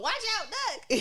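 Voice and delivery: high, loud, drawn-out comic shout; mock warning. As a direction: call out a playful alarm slowly.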